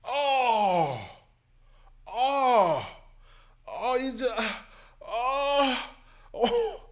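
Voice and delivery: high, repeated excited oh; cheering a debate takedown; excited exclaims. Real, not acted. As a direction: gasp it out, thrilled. Reacting to Yang's answer.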